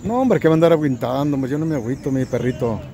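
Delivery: ordinary delivery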